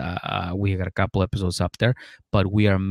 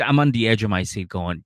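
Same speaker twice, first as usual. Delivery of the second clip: ordinary talk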